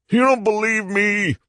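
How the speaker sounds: very high, strained cry; desperate wail. A narrator's reading. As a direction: plead in anguish to be believed. Madman desperate to be believed.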